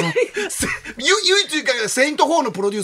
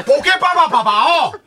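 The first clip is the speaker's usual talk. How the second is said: imitation; 6 semitones higher, 6 dB louder than usual. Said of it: "高遠力のモノマネ".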